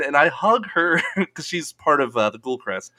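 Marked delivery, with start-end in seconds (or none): none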